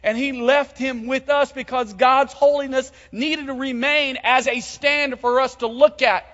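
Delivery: loud, high-pitched preaching at full force. Acted. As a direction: proclaim it to the whole church.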